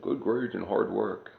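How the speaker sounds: slow, lightly voiced mocking of a boomer slogan; mocking boomer quote. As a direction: parrot their slogan slowly, with light scorn. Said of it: "it's a lie the boomers said".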